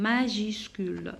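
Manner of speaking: usual speaking voice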